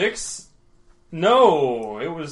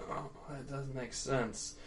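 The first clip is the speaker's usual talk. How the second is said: put-on slurred drunk; 13 dB quieter than usual. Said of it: "she's really slurring her words".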